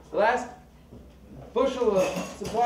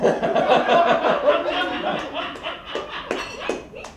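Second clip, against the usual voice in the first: laughing; 7 semitones higher than usual. Real, not acted. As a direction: laugh hard at your own joke. Laughs at own joke.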